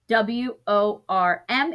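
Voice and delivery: W O R M spelled out slowly, letter by letter; slow spelling. Shown as a demonstration. Teacher spelling a word out for pupils, slowly. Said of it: "four letters".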